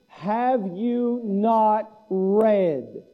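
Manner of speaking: very slow, each word drawn out; drawn-out pointed question; slow quote. Retold or imitated. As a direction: let the question hang, slowly. Quoting Jesus, drawn out.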